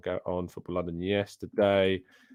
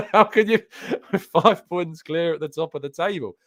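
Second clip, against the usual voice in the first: laughing; 7 semitones higher, 4 dB louder than usual. Real, not acted. Laughing disbelief at comment.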